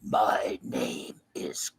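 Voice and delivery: very low, slow, breathy whisper; imitating Khan line. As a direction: drag the line out in a hushed, deep mock villain voice. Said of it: "When he says the words".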